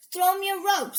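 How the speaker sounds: brisk pirate command; bossy order. A narrator's reading. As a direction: order them about. Minnie gives a pirate's order.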